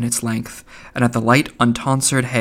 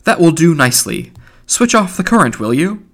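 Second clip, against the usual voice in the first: louder, higher, brisk instruction; 6 semitones higher, 5 dB louder than usual. A narrator's reading. Mulligan tells an unseen helper to switch off, brisk. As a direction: give a breezy order, voice lifted.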